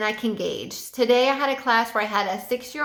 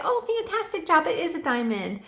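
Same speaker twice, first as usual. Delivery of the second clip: put-on much higher, bubbly praise for little kids; enthusiastic praise; 6 semitones higher than usual. Shown as a demonstration. Online teacher praising young students, excited high praise. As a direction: gush over their answer like a cheerleader. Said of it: "you have to be very enthusiastic".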